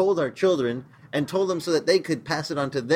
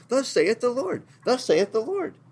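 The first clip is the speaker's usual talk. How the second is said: much higher, eager repeated proclamation; excited proclaiming; 10 semitones higher than usual. Retold or imitated. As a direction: cry it out high like runners spreading news. Prophets running around the camp.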